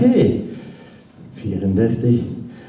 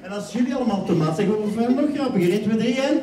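lively stage hypnotist painting a circus; hypnotic scene narration; 5 semitones higher, 5 dB quieter than usual. Acted. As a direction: sweep them into the circus scene.

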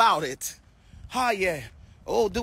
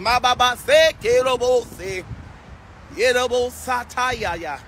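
rapid rhythmic glossolalia syllables; speaking in tongues; 3 dB quieter than usual. Real, not acted. Preacher praying in tongues on the live, rhythmic flow.

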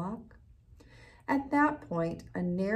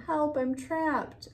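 higher, louder and slow; calling for help; 8 semitones higher, 4 dB louder, slower than usual. A narrator's reading. Butterfly trapped, calling slow and higher. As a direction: plead to be rescued. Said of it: "The voice called again".

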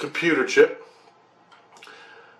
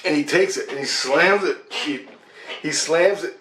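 usual speaking voice

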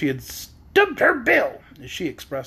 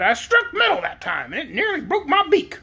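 high hen voice, complaining; rising to a high hen squawk; hurt hen; 9 semitones higher than usual. A narrator's reading. Storyteller voicing the hurt hen.

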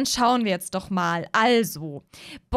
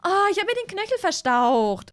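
put-on higher, whiny injured young woman; Mara's voice; 8 semitones higher than usual. Mara complaining to Moritz about her sprained ankle, whiny.

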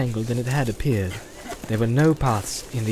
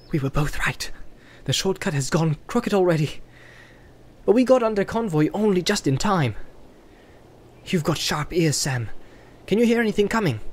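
a lightly voiced Frodo, higher, making his case; Frodo, reasoning; 7 semitones higher than usual. A narrator's reading. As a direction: speak as a hobbit proposing a shortcut. Frodo's dialogue in read story.

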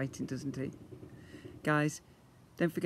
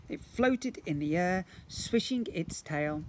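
usual speaking voice

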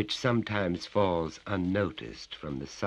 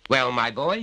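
higher, louder bullying father; menacing father; 7 semitones higher, 8 dB louder than usual. Acted. Father grips his son's neck, bullying and loud. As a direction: lean on the child with each claim.

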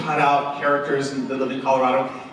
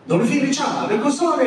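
lightly voiced old Italian man, higher; 10 semitones higher, 4 dB louder than usual. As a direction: rattle off the Italian like the old guy. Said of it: "He was like".

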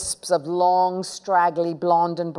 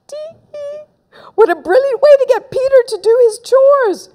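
gleeful giggle; 6 dB louder than usual. Acted. Henry gloating to himself.

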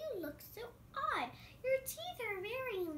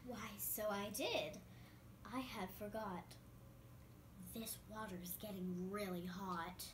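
lower, softer, fading wolf voice; weakening wolf; 10 semitones lower, 9 dB quieter than usual. Acted. Wolf stewing in the pot, growing lower and softer. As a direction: sink lower as the water heats.

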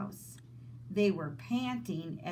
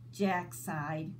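plain reading voice

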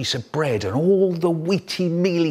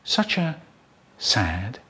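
softer, low, trailing sadness; sad and fading; 5 semitones lower, 5 dB quieter, slower than usual. Acted. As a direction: let the sadness sink. Describing a sad place.

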